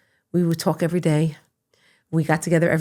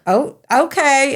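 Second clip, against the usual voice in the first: startled agreement; 8 semitones higher, 5 dB louder, slower than usual. Retold or imitated. Retold reaction to coach.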